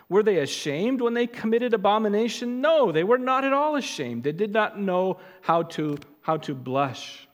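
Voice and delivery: rising fire-and-brimstone preacher voice; preaching at full force. Acted. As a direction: drive the rebuke home to the congregation. Preaching climax on shame.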